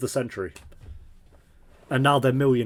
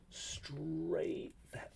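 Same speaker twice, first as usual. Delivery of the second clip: breathy whispered repeat; 6 semitones higher, 15 dB quieter than usual. Shown as a demonstration. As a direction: whisper it to yourself in disbelief.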